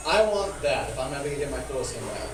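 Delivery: ordinary delivery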